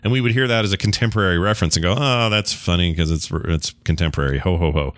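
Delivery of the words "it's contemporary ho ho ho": ordinary talk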